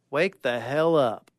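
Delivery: ordinary delivery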